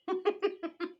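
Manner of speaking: quick high giggle; a happy burst of laughter; delighted laugh. Real, not acted. Laughing over cake.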